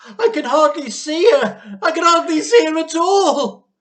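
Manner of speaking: high, loud despairing wail; desperate wail. Acted. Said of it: "Missus Cranky wailed".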